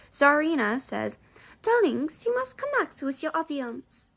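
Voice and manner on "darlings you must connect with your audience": put-on a light French accent for the teacher; mock French drama teacher. Reading the drama teacher's lines in a French accent. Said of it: "I always switch to a French, French accent".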